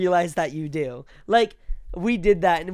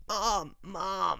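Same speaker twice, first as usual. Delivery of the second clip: imitating a choked, barely audible cry for Mom; strangled voiceless call; 8 dB quieter than usual. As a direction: call out softly through an injured throat. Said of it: "you can't hear her voice".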